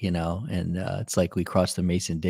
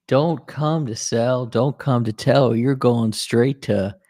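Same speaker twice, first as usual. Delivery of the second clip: slow, louder South Carolina drawl; South Carolina accent; 4 semitones higher, 5 dB louder, slower than usual. Retold or imitated. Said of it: "his South Carolina accent in my head".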